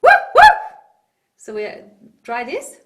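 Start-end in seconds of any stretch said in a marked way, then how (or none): 0.0-0.6 very high, loud head-voice yips; squeaky small-dog woofs; little dog bark. Shown as a demonstration. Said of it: "the little s-, dog".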